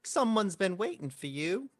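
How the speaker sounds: high, slow birdman voice; hooded Tengu. Acted. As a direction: draw it out as a high-pitched bird creature. Game master voices hooded Tengu.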